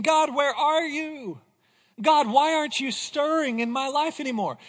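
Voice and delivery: Isaiah crying out. Retold or imitated. Isaiah crying out to an absent God, pleading loudly. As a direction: cry out to God in anguish. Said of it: "He's saying".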